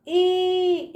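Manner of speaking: ई held long and steady; long drawn-out ई. Shown as a demonstration. Teacher showing children the big ई, vowel held long. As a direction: stretch the vowel ई far out. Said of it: "इसका उच्चारण दूर तक जाता है".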